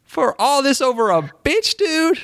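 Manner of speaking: mock the rant with rising disbelief; mock outrage. Imitating the YouTuber's outburst to cohost, high mock outrage. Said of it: "It's just like".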